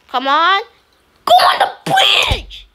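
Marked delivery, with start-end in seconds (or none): 1.2-2.7 high, slow frustrated cry; yelling. Real, not acted. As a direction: yell it at a stubborn teammate.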